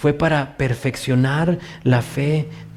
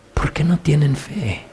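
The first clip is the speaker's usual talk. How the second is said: whispered, tender question, seeking to understand; gentle whisper. Shown as a demonstration. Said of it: "cómo cambia el tono". (susurro compasivo, con ternura)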